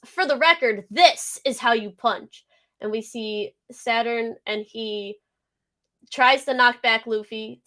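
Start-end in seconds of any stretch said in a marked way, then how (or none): 0.0-2.4 reading Luffy's line. Retold or imitated. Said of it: "And Luffy goes".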